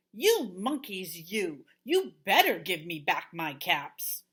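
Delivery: stamp and threaten, fed up; quite angry. Acted. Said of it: "Now he felt quite angry".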